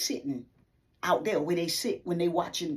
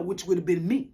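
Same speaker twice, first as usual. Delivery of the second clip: ordinary delivery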